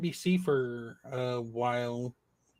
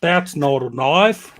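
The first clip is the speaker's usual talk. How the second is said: slow Australian Dundee line; Crocodile Dundee quote; 8 dB louder, slower than usual. Retold or imitated. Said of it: "what sort of accent".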